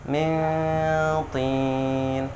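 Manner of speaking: min tin with the n hummed long; correct nasal hold. Shown as a demonstration. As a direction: hum the n before tin. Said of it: "Sekali lagi". (mintin dengan dengung dua harokat)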